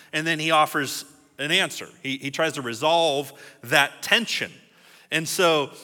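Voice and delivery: ordinary delivery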